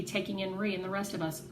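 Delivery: plain reading voice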